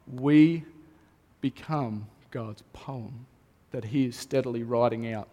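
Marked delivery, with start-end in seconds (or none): 1.4-5.3 tender hush. Acted. Pastor tells congregation they are God's poem, softly tender. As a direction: draw them in gently, almost hushed. Intimate point about God's love.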